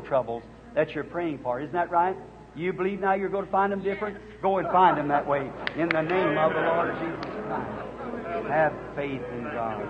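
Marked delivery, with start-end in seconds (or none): 5.8-9.8 slow, lower benediction; solemn blessing. Real, not acted. Preacher blessing a believer, slow and solemn. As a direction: bless her, slow and grave.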